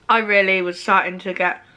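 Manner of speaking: muffled by covering hand; talking with food in mouth; chewing behind hand. Real, not acted. Seen 2: Hand covers mouth 0.6–2.5s after a bite; gone by 3.2.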